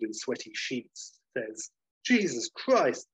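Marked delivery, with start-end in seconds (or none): 2.0-3.1 higher, slow shocked cry; startled exclamation. Retold or imitated. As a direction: gasp it out after a nightmare. Waking from nightmare.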